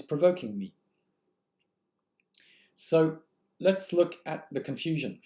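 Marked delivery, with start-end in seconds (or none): none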